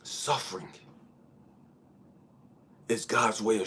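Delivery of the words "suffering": ordinary delivery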